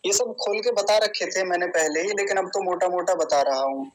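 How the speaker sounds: usual speaking voice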